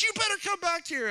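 high, mock stern mother calling; mock scolding mama. A mother calling her son back, mock scolding. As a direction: call out like a mom chasing her boy. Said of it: "I was like".

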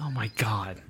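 whispered disbelief; hushed shocked whisper. Real, not acted. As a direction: breathe it out unvoiced. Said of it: "whispered reaction".

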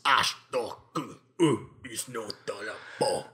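low mock-Klingon gibberish; slow, cacophonous Klingon. Faking Klingon for a friend, low cacophonous gibberish. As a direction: grunt out disjointed alien syllables. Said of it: "it was slow and cacophonous".

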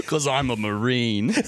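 put-on openly British accent claiming to be American; mock action hero in an unhidden British accent. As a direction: make no attempt at an American accent, stay British. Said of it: "Not even trying with an American accent".

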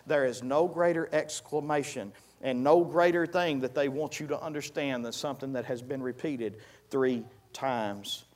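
normal talking voice

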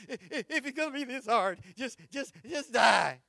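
builds to a loud cry on die; half-laughing, shouted plea to die; acted despair rising to a shout; mock despair shout. Preacher voicing Jonah's despair.

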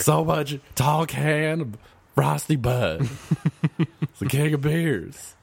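high, slow goofy zombie voice. Pretend. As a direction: groan it out like a silly zombie. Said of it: "being a zombie".

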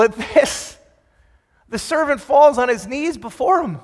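usual speaking voice

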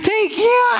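high, slow, half-shouted thanks through tears; laugh-cry like a madman. A narrator's reading. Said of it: "Umiiyak siya, tumatawa, parang baliw". (tumatawa't umiiyak na parang baliw)

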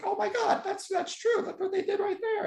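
very high, wide-eyed mock gasp of discovery; amazed realization. Fan rewatching a commentary, suddenly amazed and squealing high. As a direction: squeal it like you just cracked the secret. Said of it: "It's like".

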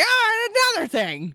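high cranky outburst; indignant old master. Acted. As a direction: squawk it high like a riled old geezer. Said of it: "He's like".

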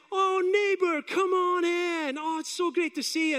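high, effusive, drawn-out welcome; welcoming neighbours. Retold or imitated. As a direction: sing out a delighted invitation in. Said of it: "And they just went".

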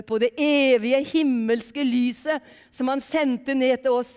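loud, emphatic proclamation; preaching. Acted. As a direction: preach it out with stretched key words.

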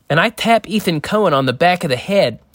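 normal talking voice

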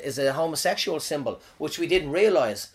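usual speaking voice

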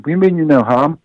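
high, projected reassurance; calling out. A narrator's reading. Chief calling to the approaching stranger, projected. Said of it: "Lopkin called out again".

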